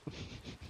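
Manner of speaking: short hushed chuckle; quick laugh with no voice; whispered laugh. Real, not acted. Player admitting the fire sucked, whispered chuckle.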